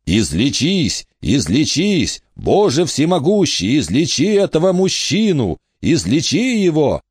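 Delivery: high, impassioned plea to God; frantic healer's invocation; desperate prayer. A narrator's reading. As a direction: implore heaven, pitch climbing as you go. Healer prays desperately over dying man.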